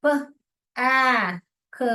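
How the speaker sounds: प, आ, ख as separate sounds with pauses. Shown as a demonstration. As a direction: sound out प, then आ, then ख. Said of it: "तो जैसे कि".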